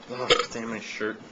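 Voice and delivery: ordinary talk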